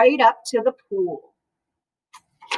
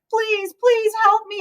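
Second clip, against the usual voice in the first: pleading; 8 semitones higher than usual. Acted. Boy begs Mister Carp on phone.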